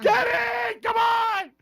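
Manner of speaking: high, yelled cheering at the screen; mock shout. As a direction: yell like a fan at the game. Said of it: "You don't wanna be screaming".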